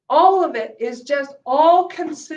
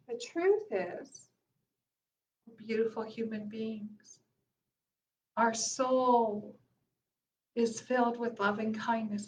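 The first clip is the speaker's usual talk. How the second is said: soft sincere affirmation; 3 semitones lower, 8 dB quieter than usual. Real, not acted. Affirming soul's goodness.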